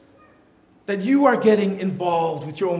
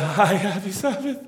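put-on timid child voice; cheery greeting sinking into a soft plea; frightened child pleading; 3 dB quieter than usual. Child greeting at church, secretly asking for help.

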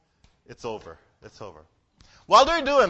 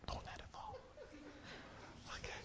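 tense whispered warning; 8 semitones higher, 28 dB quieter, faster than usual. Real, not acted. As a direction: whisper it, don't let it fall. Whispered warning during game.

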